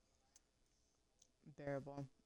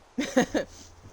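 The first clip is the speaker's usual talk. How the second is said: bursting out laughing; a loud falling laugh; 10 semitones higher, 14 dB louder than usual. Real, not acted. Laughs after bold remark.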